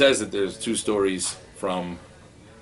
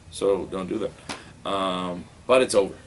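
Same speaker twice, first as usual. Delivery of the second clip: usual speaking voice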